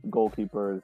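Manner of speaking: ordinary talk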